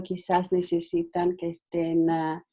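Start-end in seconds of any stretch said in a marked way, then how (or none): none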